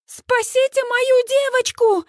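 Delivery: high, drawn-out panicked wail; frantic mother's high cry; hysterical. A narrator's reading. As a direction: plead hysterically for rescue. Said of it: "Я бьюсь в истерике".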